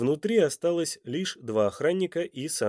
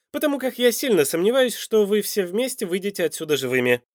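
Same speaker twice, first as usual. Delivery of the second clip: raised, loud threatening voice; menacing villain; 9 semitones higher, 5 dB louder than usual. A narrator's reading. Villain warns the family they won't leave, loud and menacing. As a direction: threaten them, pitched up and pressing.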